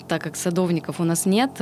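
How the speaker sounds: usual speaking voice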